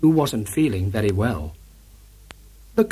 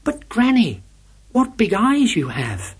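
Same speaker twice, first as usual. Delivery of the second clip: put-on little girl voice; 8 semitones higher than usual. A narrator's reading. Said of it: "replied the wolf".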